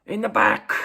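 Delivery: high, very loud fan shout; sudden mock holler; imitated yell. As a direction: bellow it at the players. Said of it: "he started yelling out things like".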